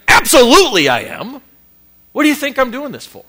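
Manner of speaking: loud, defiant comeback to the critic; defiant retort. Real, not acted. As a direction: fire back, owning it proudly.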